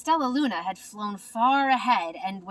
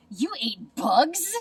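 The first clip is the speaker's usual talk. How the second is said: slow, halting voiced bat; stuttering; 3 semitones higher, 4 dB louder, slower than usual. A narrator's reading. Bat stammering at Stellaluna's bird story, slow and halting. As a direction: stumble over it in disbelief, slowly. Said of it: "stuttered one".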